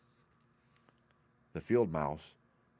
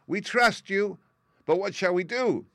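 high, loud tiny voice; squeaky mouse queen; 12 semitones higher, 6 dB louder than usual. A narrator's reading. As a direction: ask like a small queen. Mouse queen asks the plan.

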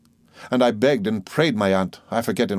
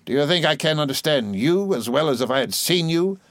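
pressing aunt; 7 semitones higher than usual. A narrator's reading. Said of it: "pursued my aunt".

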